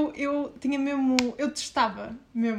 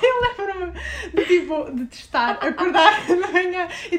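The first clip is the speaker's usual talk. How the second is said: slow, high, loud, laughing hard; 6 semitones higher, 7 dB louder, slower than usual. Real, not acted. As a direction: talk through the giggles. Recalling while laughing.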